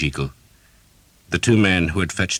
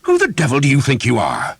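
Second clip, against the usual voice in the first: higher, loud furious challenge; lost temper; 10 semitones higher, 7 dB louder than usual. A narrator's reading. Priest losing his temper at the knight, furious. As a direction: let your temper explode. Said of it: "Father Hobb had a temper, and he lost it".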